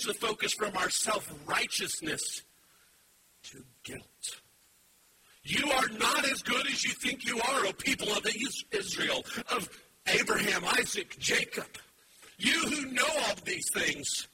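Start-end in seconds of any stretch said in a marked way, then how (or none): 5.4-14.3 loud, rising preacher's call; fiery sermon voice; full-force preaching. Acted. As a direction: drive it home to the congregation.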